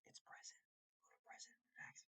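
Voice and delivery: breathy whispered punchline; whispered aside. Acted. Whispering the joke punchline to the viewers. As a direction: drop to a secret whisper for the joke.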